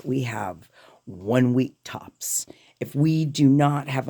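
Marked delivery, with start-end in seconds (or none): 0.0-2.4 hushed, slow urgent warning; whispered, slow and grave. Real, not acted. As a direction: lean in and whisper it.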